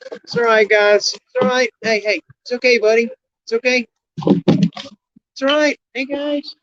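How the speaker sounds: high, gentle calming coo; soothing scared goats. Real, not acted. Goats are panicking.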